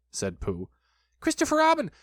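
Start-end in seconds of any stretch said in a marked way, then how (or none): 1.2-1.9 high, loud triumphant squeal; thrilled little creature; excited cry. A narrator's reading. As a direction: call out the discovery, stretching the end. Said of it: "cried Piglet".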